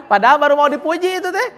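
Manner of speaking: teasing, laughing correction. Real, not acted. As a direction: talk while chuckling at the slip. Amused at student losing the word.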